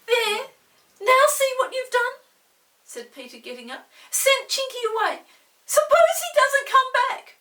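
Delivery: very high, loud reproach; reproachful boy. Acted. Peter blames Molly for Chinky leaving. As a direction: blame her like a worried brother.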